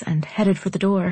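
ordinary delivery

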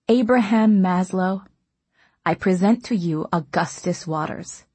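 ordinary delivery

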